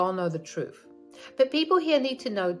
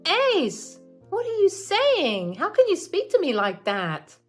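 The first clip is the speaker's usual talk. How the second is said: shocked mother; 12 semitones higher, 6 dB louder than usual. Acted. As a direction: gasp at your son's words.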